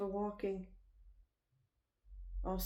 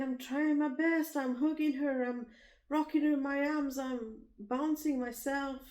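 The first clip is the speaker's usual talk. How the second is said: higher and louder, slowing down; desperate protest; 6 semitones higher, 5 dB louder than usual. Retold or imitated. To the husband who won't help, higher, louder desperate plea. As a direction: plead that you're doing everything. Pleading with husband over baby.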